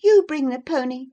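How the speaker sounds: put-on high, loud girlish order; indignant exclamation. Girl turns to order the woman, indignant. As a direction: command in high-voiced indignation. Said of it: "tears sprang into her eyes with indignation".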